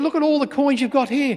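high, indignant dad voice; acted parental outrage; irate father. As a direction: confront your child with the evidence. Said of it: "starts to get really irate and really upset".

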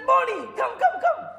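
high, loud, eager call of the name; mock joyful old woman calling. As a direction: call him in with delight, mimicking her. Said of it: "she said".

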